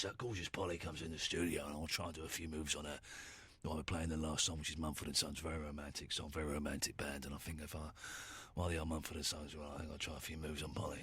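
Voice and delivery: whispering plans about Polly; hushed aside. Acted. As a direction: lean in and confide quietly. Host lowers voice, confiding.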